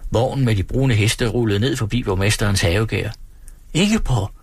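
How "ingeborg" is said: "Ingeborg!" called out high, falling at the end; lady in the carriage calling to a friend, first call; calling a name. A narrator's reading. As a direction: call "Ingeborg!" across the street. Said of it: "råbte den ene af damerne".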